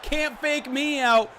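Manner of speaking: loud, high mock taunt from the defender. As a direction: shout it like the linebacker who wasn't fooled. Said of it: "Brian Branch says".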